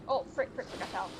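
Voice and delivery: ordinary talk, nothing marked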